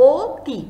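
অতি said as "oti", first vowel rounded like ও; closed o sound. Shown as a demonstration. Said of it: "ও এর মতো করে".

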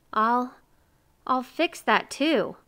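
halting, slow reluctant agreement; weakly. A narrator's reading. Miser gives in to neighbor's demand, weakly. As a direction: stammer a slow, weak consent. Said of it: "said Mister Miser weakly".

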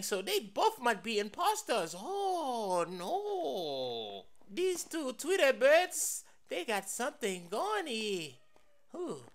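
ordinary talk

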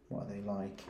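ordinary delivery

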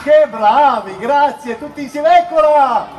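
loud, high, delighted cheering; excited praise. Real, not acted. Host thrilled that the children shouted together, loud and high. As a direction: whoop them on like a game-show host. Crowd answers host's cue.

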